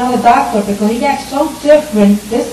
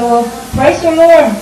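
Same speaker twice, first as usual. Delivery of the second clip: louder, higher, slowed praise; joyful cry of praise; 6 semitones higher, 8 dB louder, slower than usual. Retold or imitated. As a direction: lift it up like sudden relief. Retold joyful reaction to doctor's news.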